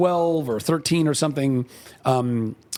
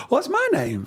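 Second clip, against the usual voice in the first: a hint of Forrest, pitched much higher; surprised question in a raised imitating voice; lightly voiced Forrest; 11 semitones higher than usual. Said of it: "He goes".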